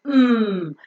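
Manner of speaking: "Mm" sustained with air through the nose; nasal M sound. Shown as a demonstration. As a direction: hum a long "Mm", lips closed. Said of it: "You could feel the air going out through your nose".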